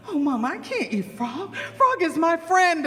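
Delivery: put-on wailing child's protest; plead, lifting the voice at the end; pleading child snake. Snake Son refuses to eat his friend, pleading.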